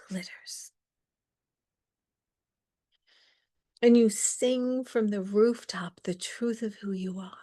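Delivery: hushed, breathy-free whispered teaching with long pauses. Acted. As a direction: speak it like a gentle blessing. Spiritual teaching, whispered passages.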